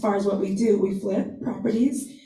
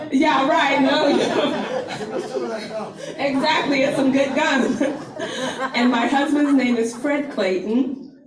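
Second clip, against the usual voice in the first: laughing; 4 semitones higher than usual. Real, not acted. Laughing after number slip.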